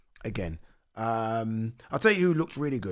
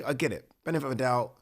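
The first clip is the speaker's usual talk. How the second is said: normal talking voice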